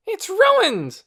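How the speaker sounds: high, loud furious scream. A narrator's reading. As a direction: scream it at her. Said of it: "Torin screamed".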